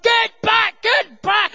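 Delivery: high, loud urgent shout; re-enacted yell to clear the way; shouted command. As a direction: shout the crowd back. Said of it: "eventually I shouted again".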